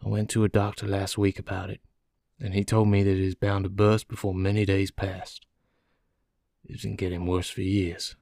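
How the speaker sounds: weary but composed confession; placid, resigned prisoner. Acted. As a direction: speak calmly of your coming death.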